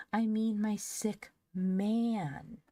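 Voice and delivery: put-on high, very slow child's insistence; decisive. A narrator's reading. Phronsie insists on whom she means, decisive. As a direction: stretch the words out firmly. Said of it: "said the child decisively".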